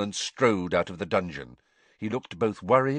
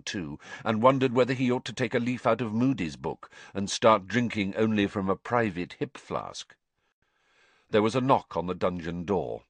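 ordinary delivery

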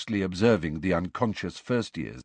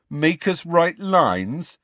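slow, high, louder smirking taunt; 9 semitones higher, 5 dB louder, much slower than usual. A narrator's reading. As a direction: tease with a smirk.

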